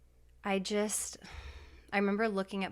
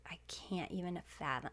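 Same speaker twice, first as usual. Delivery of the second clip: whispered disbelief; 8 dB quieter than usual. Retold or imitated. Told she must leave babies.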